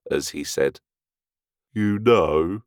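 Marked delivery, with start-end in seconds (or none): 1.7-2.6 very gravely. A narrator's reading. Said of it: "he added very gravely".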